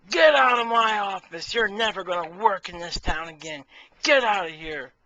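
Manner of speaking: loud, high furious yell; hammed-up furious shout; angry boss. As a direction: throw him out of the office. Angry boss throws applicant out.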